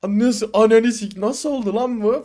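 loud, slow, drawn-out disbelief; stunned outburst. Retold or imitated. Friends at the café, seeing the real girl arrive, stunned loud cursing. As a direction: let the shock spill out slowly and loudly.

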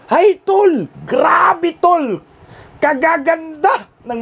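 hyping up the women to a friend; excited rave. Real, not acted. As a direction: gush like you can't believe it. Raving about pretty women.

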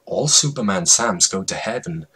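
plain reading voice

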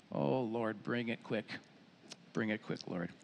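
low, very soft plea; quiet, heartfelt aside to God; hushed prayer. Real, not acted. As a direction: pray it under your breath. Aside prayer to God after verse.

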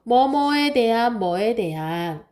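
"뭐뭐에 대한" chanted slowly with long vowels; slow, drawn-out stress on "대한"; key phrase emphasized. Shown as a demonstration. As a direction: stretch "뭐에 대한" so it gets memorized. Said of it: "그거 잘 알아둬야 돼요".

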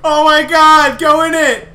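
high, loud panicked yell; scared yelling. Real, not acted. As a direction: yell in sudden fright. Jump scare in horror game.

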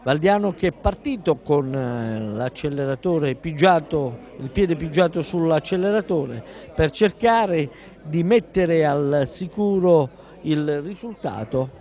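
ordinary delivery

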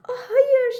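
high, loud rabbit dismay. Acted. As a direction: gasp it out in alarm. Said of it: "dedi tavşan".

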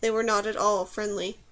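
usual speaking voice

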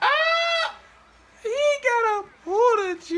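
high, loud gamer outburst; excited yell. Real, not acted. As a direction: shout in excited disbelief. Gaming outburst.

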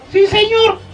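put-on high-pitched little child reply; meek child. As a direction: answer small and high, like a scolded kid. Frightened child answering parent, acted. (vocecita aguda de niño obediente)

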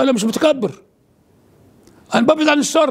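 retold protest, pitched high; defensive retort. Telling the priest he keeps away from evil, high defensive protest.

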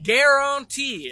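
slow, loud, stretched out Guarantee; drawn-out quoted word. Retold or imitated. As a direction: drag out Guarantee like the friend. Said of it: "as our good friend said".

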